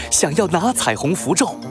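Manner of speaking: ordinary delivery, nothing marked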